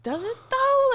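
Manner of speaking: high-pitched sceptical question; squeaky retold disbelief; mock doubt. Retold sceptical retort to the woman.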